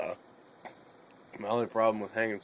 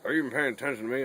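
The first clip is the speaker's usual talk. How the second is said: rushed, higher, louder mock demand; fast demanding dad; 5 semitones higher, 4 dB louder, much faster than usual. Son retells his dad demanding attention, fast and louder. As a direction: fire it off quickly like an annoyed father. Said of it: "And he's like".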